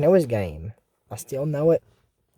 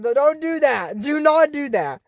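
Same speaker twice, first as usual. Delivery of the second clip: scolding plea; 6 semitones higher than usual. Real, not acted. Scolds character.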